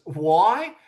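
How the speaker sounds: usual speaking voice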